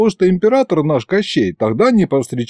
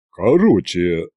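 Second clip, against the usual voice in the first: low, halting bass; deep, awkward restart of a speech; flustered duke; 8 semitones lower, slower than usual. A narrator's reading. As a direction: lose the thread, pitched well down. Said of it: "окончательно сбившись".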